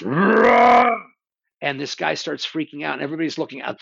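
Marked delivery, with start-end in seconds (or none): 0.0-1.0 loud, high and slow; booming roar. Retold or imitated. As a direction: let the word roar boom out. Describing monsters' roar.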